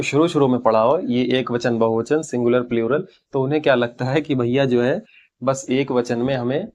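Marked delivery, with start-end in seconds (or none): none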